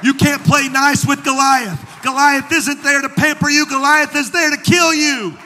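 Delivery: high, loud revival preaching; full-force preaching. Real, not acted. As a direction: shout it to the congregation in driving phrases.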